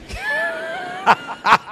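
high, ringing laugh; burst of laughter. Real, not acted. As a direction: laugh out at the punchline. Laughs at his own story.

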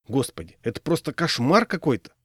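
plain reading voice

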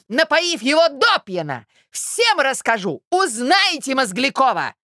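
high-pitched ranting threats; boiling rage. A narrator's reading. Jilted suitor threatens to expose her, boiling rage. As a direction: rant on, stretching the outraged words. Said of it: "закипел от ярости".

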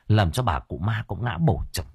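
usual speaking voice

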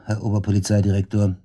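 ordinary talk, nothing marked

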